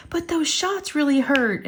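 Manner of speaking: high, slow mock whimper; imitated child's whine. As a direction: whine like a sore, pitiful child. Hurt child complaining to mother.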